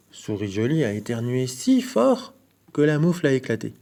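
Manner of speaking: ordinary delivery, nothing marked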